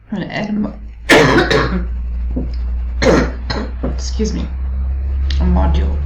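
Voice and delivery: strained voice after coughing fit; choking cough. Real, not acted. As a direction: speak through a choking cough. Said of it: "something went down the wrong way".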